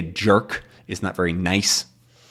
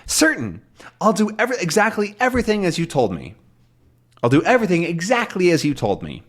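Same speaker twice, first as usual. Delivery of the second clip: higher, fast assurance; confident Tintin; 7 semitones higher, faster than usual. Retold or imitated. Tintin assures the captain he'll follow orders, higher and fast. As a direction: reassure quickly and eagerly. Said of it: "he says".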